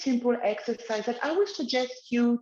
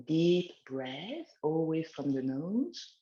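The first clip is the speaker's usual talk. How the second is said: slow, soothing exercise guidance; guided relaxation; 2 semitones lower than usual. Acted. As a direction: lead gently, eyes closed. Leads a guided neck exercise.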